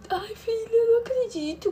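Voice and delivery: lamento agudo, imitando choro; choro da mãe. Retold or imitated. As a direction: imite a mãe chorando, bem agudo. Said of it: "Começou a chorar". (lamento choroso de mãe incrédula)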